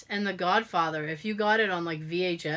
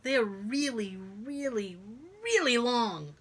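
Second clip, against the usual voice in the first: each really stretched, softly; drawn-out repetition; 6 dB quieter, slower than usual. Retold or imitated. Patient warning the therapist, dragging out really really long. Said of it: "I was like".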